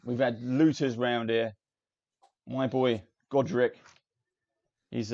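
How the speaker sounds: loud grumbling dwarf blacksmith; gruff dwarf smith. Acted. GM voices dwarf smith Wellham.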